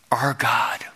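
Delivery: hushed whisper of devotion. Real, not acted. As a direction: breathe the words out reverently.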